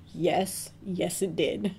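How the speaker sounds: usual speaking voice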